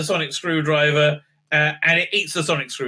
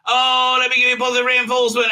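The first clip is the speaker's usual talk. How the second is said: mock gushing; 8 semitones higher, 4 dB louder than usual. Mocking the doctor's pep talk.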